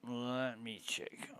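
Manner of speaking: slow, breathy whisper to himself. Real, not acted. Checking the game, whispering to himself slowly. Repeating phrase, getting quieter.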